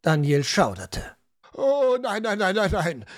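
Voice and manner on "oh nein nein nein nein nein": put-on old showman cries out in alarm. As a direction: rush in horrified. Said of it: "rief ein älterer Schausteller entsetzt".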